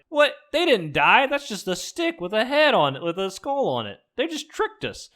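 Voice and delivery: mock shock at being fooled; outraged realization. Za realizes the trick.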